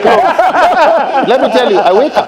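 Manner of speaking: high, loud, laughing storytelling; cracking up mid-story; laughing while talking. Real, not acted. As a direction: tell it through fits of laughter.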